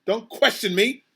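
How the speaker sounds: loud, testy rebuke; barked order. A narrator's reading. Mister Payne pulling rank on the captain, barked order. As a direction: shut the captain down firmly. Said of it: "barked Mister Payne".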